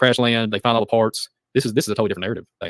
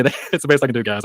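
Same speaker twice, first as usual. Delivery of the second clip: ordinary delivery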